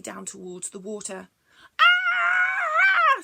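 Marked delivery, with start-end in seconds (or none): 1.8-3.2 much higher, loud long scream; tumbling creature screaming. Acted. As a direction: scream while rolling downhill. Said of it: "screamed the Scrack".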